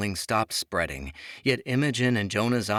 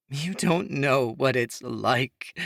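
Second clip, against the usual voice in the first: slow, higher, tearful; sobbing; 6 semitones higher, slower than usual. A narrator's reading. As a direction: break down as she says it. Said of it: "Imogen sobbed".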